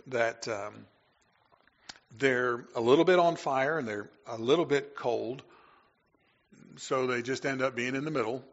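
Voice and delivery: normal talking voice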